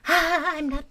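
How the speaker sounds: put-on high, wistful girlish sigh; a girl lamenting a secret she couldn't film; dreamy lament. A narrator's reading. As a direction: pine over the missed footage. Girl laments missed recording.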